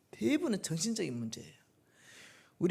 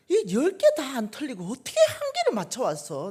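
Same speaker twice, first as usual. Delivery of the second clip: put-on high, loud delighted praise of her child; a mother gushing over one right answer; excited praise; 8 semitones higher, 9 dB louder than usual. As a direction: cheer the kid on, thrilled. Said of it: "내가 그랬어요".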